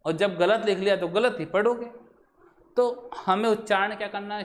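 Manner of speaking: normal talking voice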